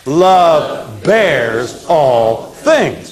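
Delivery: very slow, every word drawn out for the class; slow recitation. Shown as a demonstration. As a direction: chant it slowly so they can repeat. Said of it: "Say it with me".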